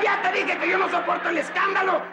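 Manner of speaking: high-pitched furious shout; exasperated yelling. Acted. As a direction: blow up at the noisy child. Father explodes at crying girl.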